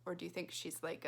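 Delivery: ordinary delivery, nothing marked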